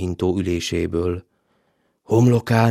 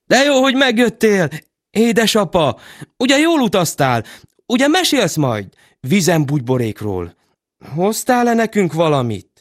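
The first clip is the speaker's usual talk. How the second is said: put-on high eager children's voices; happy children; 10 semitones higher than usual. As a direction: ask it bubbling with joy.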